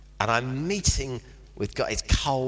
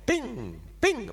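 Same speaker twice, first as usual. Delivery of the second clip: mock bing; 10 semitones higher than usual. Pretends a sat-nav chime.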